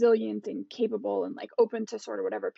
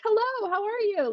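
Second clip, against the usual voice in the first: pitched way up, thin and nervous; a nervous voice jumping much higher for a hello; 12 semitones higher than usual. Shown as a demonstration. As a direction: squeak it out like you're anxious. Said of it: "I'll talk like this".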